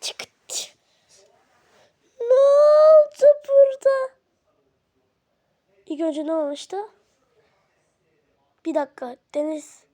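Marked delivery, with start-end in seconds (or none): 2.2-4.1 high, loud, drawn-out shock; shocked outcry. Acted. Walking in to find the mess, shocked outcry. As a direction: cry out at what has happened.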